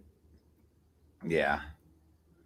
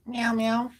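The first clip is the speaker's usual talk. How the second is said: mock meow; 10 semitones higher than usual. Meowing to the cat.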